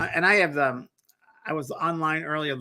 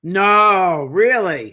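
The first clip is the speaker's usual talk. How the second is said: loud, high, astonished reaction; big, high-pitched gasp of disbelief in words; amazed disbelief; 5 semitones higher, 9 dB louder, much slower than usual. Real, not acted. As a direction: blurt it out in real amazement. Listener reacts to the owl coincidence.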